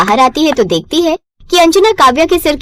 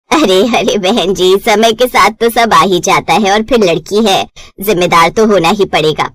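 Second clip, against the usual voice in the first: groom's mother and bride's mother settling the match, lower; older mother's voice; 5 semitones lower than usual. Acted. Mothers discussing marriage match.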